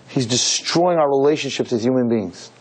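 usual speaking voice

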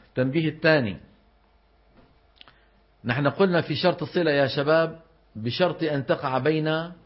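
ordinary talk

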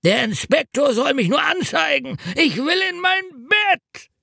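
put-on high, whining drunk voice; hungover road mender. A narrator's reading. Road mender to narrator about his bed, high whine.

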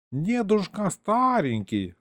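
high, drawn-out condescension; dismissive. A narrator's reading. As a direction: wave the old man off, slowly. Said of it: "отмахнулся Гришка".

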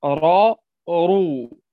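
"راء، رو" with thick emphatic r; heavy raa, ruu. Shown as a demonstration. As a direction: sound out "راء، رو" full and heavy. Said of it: "فهي تفخم".

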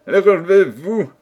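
loud, high kingly order; royal command. A narrator's reading. King telling his soldiers to get up, commanding. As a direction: order them up with hearty authority.

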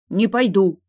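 put-on loud, stubborn boy's refusal; digging in. A narrator's reading. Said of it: "упёрся пацан".

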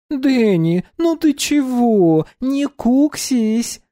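high, slow coaxing coo; coaxing girl voice. Acted. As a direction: coax him out of sulking.

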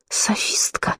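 sharp furious hiss, very soft; whispered fury. A narrator's reading. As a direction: hiss it like striking a match. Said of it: "прошипела она, словно чиркнула спичкой". (шипящий яростный шёпот)

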